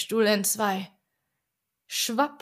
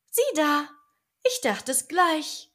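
lightly voiced widow; 7 semitones higher than usual. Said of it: "mild und weich sprach".

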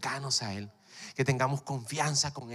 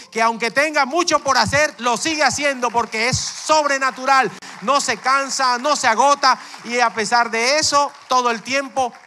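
high, loud fervent preaching; full-force preaching; 11 semitones higher, 12 dB louder than usual. Real, not acted. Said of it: "preaching at full force".

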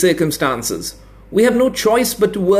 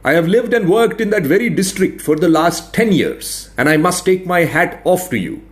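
higher, dryly mocking; sarcastic administrator; 6 semitones higher than usual. A narrator's reading. Veteran administrator ridiculing the expert's border, sarcastic. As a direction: lay out the absurdities with polite scorn.

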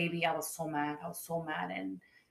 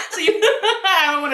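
high, loud laughing tease; 9 semitones higher, 9 dB louder than usual. Real, not acted. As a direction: talk through a big real laugh. Teasing about blocking, both laughing.

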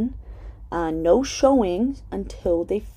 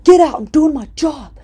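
higher, much louder barked order; angry shout; 9 semitones higher, 11 dB louder than usual. Retold or imitated. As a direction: yell her out of the room. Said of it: "Luke's like".

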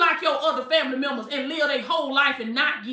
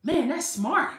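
higher, faux-amazed oh okay; mock impressed listener; 4 semitones higher, 3 dB quieter than usual. Preacher acting out learning from the devil, mock amazed. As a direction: play along wide-eyed and impressed.

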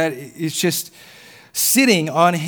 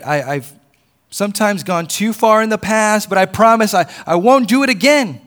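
loud, high, desperate pleading; loud chattering lizard; 3 semitones higher, 8 dB louder than usual. Acted. Lizard pleads with the man to spare it, chattering loudly. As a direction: chatter and beg at rising volume. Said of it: "the lizard began chattering loudly".

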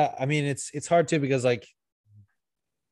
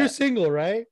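very high squeaky imitation; squeaky mock-innocent question; mock taunting boast; 10 semitones higher, 3 dB louder than usual. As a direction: needle them in a hammed-up high voice. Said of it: "She was like".

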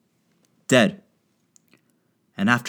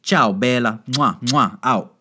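mock Italian goodbye; 3 semitones higher, 4 dB louder, slower than usual. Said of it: "That's the most Italian shit ever".

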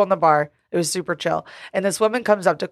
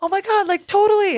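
put-on much higher, bubbly valley girl; higher, giddy 'totally'; valley girl gush; 12 semitones higher than usual. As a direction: gush like new besties. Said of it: "And they're like".

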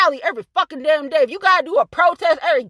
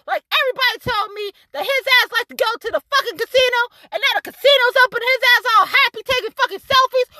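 ordinary delivery

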